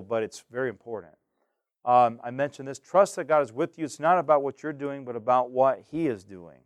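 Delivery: ordinary delivery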